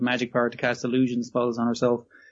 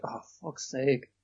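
slow, softer fed-up groan at the show; exasperated mutter; 8 dB quieter, slower than usual. Retold or imitated. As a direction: sigh it out, done with this. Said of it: "I was like".

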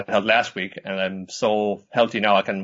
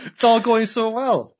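mock lament; 12 semitones higher, 3 dB louder, slower than usual. Mock lament about the episode.

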